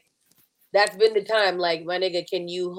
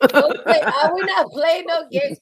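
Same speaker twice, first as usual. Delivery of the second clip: laughing protest; 8 semitones higher, 6 dB louder than usual. Real, not acted.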